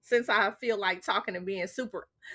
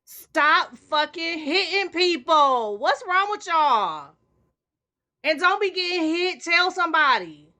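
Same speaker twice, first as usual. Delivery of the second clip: louder, higher, fierce appeal; angry plea; 6 semitones higher, 6 dB louder than usual. Real, not acted. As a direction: scold the whole audience, hard. Angry PSA about hitting people.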